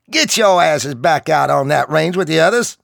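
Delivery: loud, high barked order; gruff drill sergeant. Acted.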